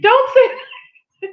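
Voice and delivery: high, drawn-out playful objection; playful protest. Real, not acted. Wife objects to husband's remark, playfully scolding. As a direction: protest teasingly, stretching the words.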